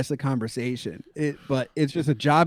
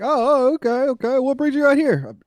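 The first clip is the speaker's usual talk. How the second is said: mock curious barber; 10 semitones higher, 4 dB louder than usual.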